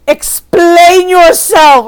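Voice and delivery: slow, loud, stern mother; imitating a stern mother demanding an explanation. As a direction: drag it out as a stern order.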